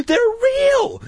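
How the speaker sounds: high, loud shout of alarm; alarmed cry. A narrator's reading. Jay seeing the snakes are real, crying out. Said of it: "Jay cried".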